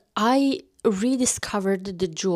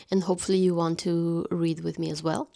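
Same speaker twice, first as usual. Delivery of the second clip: whispering. Real, not acted. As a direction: drop to a whisper. Playful whispered aside to viewer.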